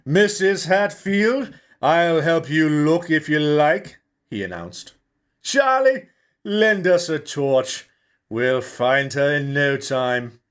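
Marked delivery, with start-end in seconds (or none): 0.0-4.0 slow, loud, higher grand voice; pompous self-important. A narrator's reading. Costume actor offering help, pompous and loud. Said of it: "stood full of self-importance". 5.4-10.4 slow, loud, higher grand voice; pompous self-important. A narrator's reading. Costume actor offering help, pompous and loud. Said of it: "full of self-importance".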